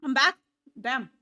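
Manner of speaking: ordinary talk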